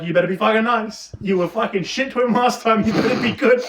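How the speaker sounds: put-on dad telling the kids they'd better behave, loud and stern; angry dad scolding. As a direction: bark a warning at the kids.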